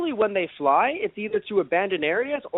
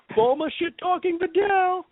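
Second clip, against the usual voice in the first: mock disbelief; 11 semitones higher, slower than usual. Exclaiming at Bulma's remark.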